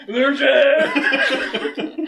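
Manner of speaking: high, loud hammed-up wail; mock wailing. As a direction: wail it in fake despair. Player hams up weeping confession.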